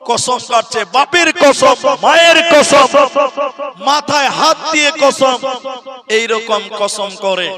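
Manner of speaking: hammering out the oaths; preaching build-up. Acted.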